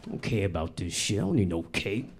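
mock tough guy brushing off a cake; gruff macho friend. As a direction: macho friend refusing his birthday cake. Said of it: "He was like".

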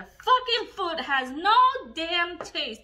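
annoyed rant. Real, not acted. Diet food complaint.

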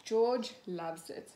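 ordinary delivery, nothing marked

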